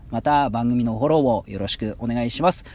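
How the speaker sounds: usual speaking voice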